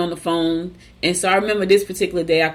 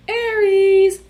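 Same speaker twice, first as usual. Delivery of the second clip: announcer sign call; 13 semitones higher, 4 dB louder than usual. Acted.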